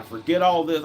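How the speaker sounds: ordinary delivery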